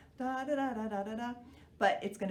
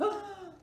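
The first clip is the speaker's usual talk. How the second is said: gasp; 12 semitones higher than usual. Acted. Elephant falls through trampoline.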